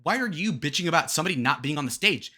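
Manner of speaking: normal talking voice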